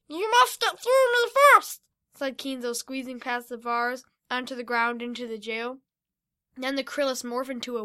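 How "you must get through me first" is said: much higher, loud defiant voice; defiant hero. Acted. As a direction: stand up to the villain. Kinzo defies the villain.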